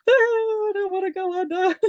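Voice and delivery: high, laughing mock whine of dread. Recalling going under anaesthetic, a high half-laughing mock fear. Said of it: "I was just like".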